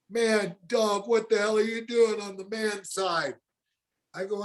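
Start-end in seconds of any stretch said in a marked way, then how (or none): 0.1-3.4 higher, strained voice of a cornered man calling out; retold suspect calling out. Cornered shooter calls to Dog from the house, higher strained voice. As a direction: play the holed-up shooter yelling back.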